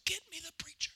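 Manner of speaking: faint unvoiced whisper of a man just revived; weak whisper. Revived grandfather begging for the preacher, whispered. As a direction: breathe the words out barely voiced. Dying man's first words in recovery.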